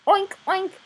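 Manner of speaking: high squealing oink oink; piglet oinks. Acted. Piglet oinking at the family, high squeal. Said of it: "said the piglet".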